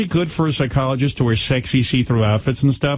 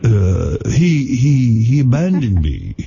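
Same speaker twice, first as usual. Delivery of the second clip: a light mock of a spaced-out storyteller; spacey alien-abductee impression; slower than usual. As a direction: drift through it like a tripped-out guest. Howard riffs on Riley's tale.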